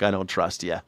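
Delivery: ordinary talk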